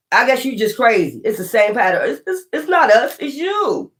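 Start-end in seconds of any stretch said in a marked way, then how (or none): 2.1-3.9 high mock voice, shifting blame; mocking exes. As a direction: parrot them in a higher, scornful voice. Mocking what exes claim.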